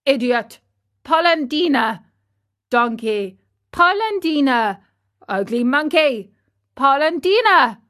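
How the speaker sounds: indignant name-calling, Polendina pitched high; trading insults. Acted. Two quarrelling old men trading names, indignant. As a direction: toss insults back and forth, heating up.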